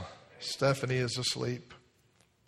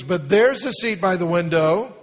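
put-on higher, louder child's insistence; insistent little girl; 5 semitones higher, 9 dB louder than usual. Girl insisting to her parents, high and loud. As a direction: push back like a stubborn kid.

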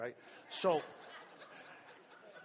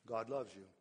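low and soft, gently reassuring; soft reassurance; 5 semitones lower, 3 dB quieter than usual. Retold or imitated. Pastor answering a troubled member, soft and reassuring. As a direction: tell them softly God loves them.

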